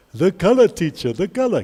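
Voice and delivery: pupils calling out the answer, voice put on; imitated eager students. Students answer the teacher's question, in a put-on eager pupil voice. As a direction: answer teacher like a keen class in chorus.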